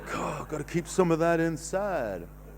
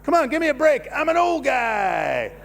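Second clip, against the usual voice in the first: high, pleading mock outburst; mock protest; 7 semitones higher than usual. Mock protest at audience's laughter.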